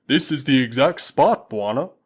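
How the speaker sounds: loud, slow, scornful warrior; booming Waziri warrior, slowed. Acted. Usula mocking the impostor to Tarzan, loud and slow. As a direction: drag it out with relish.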